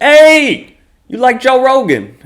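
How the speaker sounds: high, loud hawker call. Retold or imitated. Hawker calling to passersby on street corner, loud and high. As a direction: hail strangers like a street promoter.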